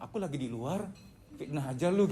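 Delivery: very soft, put-upon mock devil; soft-spoken devil. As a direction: grumble quietly as a wrongly blamed devil. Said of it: "Setannya ngomong". (setan menggerutu pelan)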